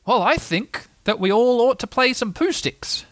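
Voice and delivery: put-on high, slow boyish proposal; lifted, drawn-out character voice; deciding Christopher Robin. A narrator's reading. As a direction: announce the plan deliberately. Christopher Robin announces his decision.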